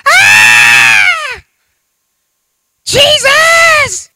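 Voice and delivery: shrill terrified shout jumping way up; hammed-up coaster scream, loud and high; screaming like a little girl. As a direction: scream in panic as the coaster drops. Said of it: "they're screaming like a little girl".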